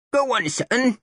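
put-on high croaky toothless old lady; croaking old woman. A narrator's reading. Toothless old lady urging the boy to enlist, croaking. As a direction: croak it like a crone. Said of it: "she croaked".

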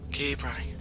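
breathy hushed repeat; scared whisper. Real, not acted. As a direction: whisper it again, tense.

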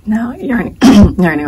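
cracking, losing the voice. Real, not acted. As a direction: voice giving out mid-word. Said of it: "oh, I'm losing my voice".

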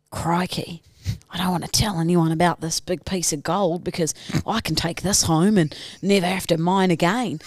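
put-on low, broad rural Australian accent; rural Australian miner. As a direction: play an Aussie bush gold miner. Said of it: "rural Australian gold miner".

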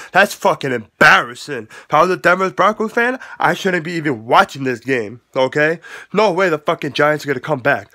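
usual speaking voice

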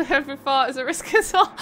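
real laughter breaking into the words; laughing. Real, not acted. Streamer reads out a fart joke from chat, laughing. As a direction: struggle through the joke while giggling. Reading a joke while cracking up.

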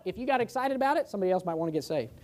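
ordinary delivery

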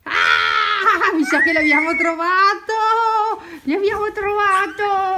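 high, loud laughing shout; excited shout. Real, not acted. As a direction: cry out with joy at finding him. Found the hiding child.

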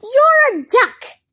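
high, loud, drawn-out disbelief; exasperated shout. A narrator's reading. Dodsworth to a duck who can't swim, exasperated shout. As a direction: hammer each word slowly, loudly. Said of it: "shouted Dodsworth".

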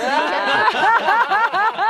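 grand éclat de rire aigu et fort; éclate de rire franchement, plus haut et plus fort. Real, not acted. Rit de son propre sketch. (fou rire sonore qui part vers l'aigu)

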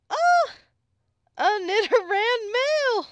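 loud, high, drawn-out cry of excitement; thrilled shout. Real, not acted. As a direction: shriek it out, overjoyed at the find. Thrilled by a rare encounter.

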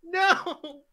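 high, loud yell of "no"; dismayed shout. Real, not acted. Player watches own turn go badly, dismayed shout. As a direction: cry out as it goes wrong. Own shot going wrong.

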